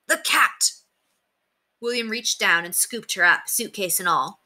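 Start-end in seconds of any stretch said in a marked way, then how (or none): 0.1-0.7 high-pitched cry of warning; urgent high warning; alarmed cry. A narrator's reading. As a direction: cry out the danger. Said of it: "cried the voice in his belt pack".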